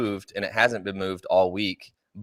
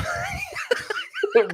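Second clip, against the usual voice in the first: high burst of laughter. Real, not acted. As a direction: squeal of laughter. Laughs at own confession.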